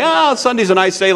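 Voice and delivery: high-pitched, breezy mock realization; mock breezy whim. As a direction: toss it out like a sudden casual whim. Mock casual decision by the church.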